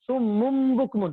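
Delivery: سم مم بکمن drawn out slowly with a nasal hum on مم; slow recitation demo. Shown as a demonstration. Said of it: "تو اس سے پڑھیں گے ہم". (سم مم بکمن آہستہ، غنّے کے ساتھ)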